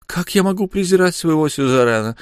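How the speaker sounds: light female character voice; Lyu's voice. A narrator's reading. Lyu's voice read aloud.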